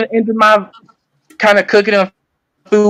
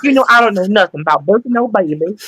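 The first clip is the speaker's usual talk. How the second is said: playful, hammed-up Southern lady accent; faster than usual. Shown as a demonstration. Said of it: "I can do a Southern accent too".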